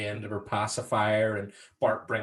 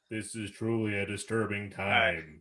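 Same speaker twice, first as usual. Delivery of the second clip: mock solemn, deeper put-on voice; James Earl Jones; 3 semitones lower than usual. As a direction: intone it like a grave movie narrator. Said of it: "James Earl Jones".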